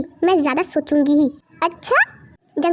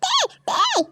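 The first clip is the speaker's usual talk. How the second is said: much higher frantic call; desperate call; much slower than usual. Acted. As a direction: cry out for her in panic. Calling out for Tae. (घबराकर पुकारते हुए)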